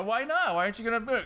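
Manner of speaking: high-pitched pleading mock salesman; silly high dub of a pushy seller; car dealership voice. Dubbed dealer asking the buyer why not, high put-on voice. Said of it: "that's my car dealership voice".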